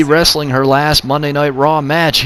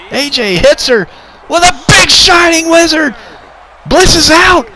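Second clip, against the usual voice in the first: ecstatic shout; 11 semitones higher than usual. Real, not acted. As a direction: explode as the three-count lands. Calling the winning pin.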